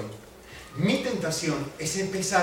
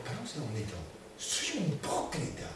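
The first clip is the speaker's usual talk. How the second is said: quiet confession; 4 semitones lower, 9 dB quieter than usual. Real, not acted. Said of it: "solemn self-confession".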